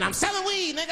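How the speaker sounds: put-on high-pitched, defiant baby; baby's voice. Baby answering a man in a limousine, high defiant voice. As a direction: pipe up high as a cheeky baby. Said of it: "The baby said".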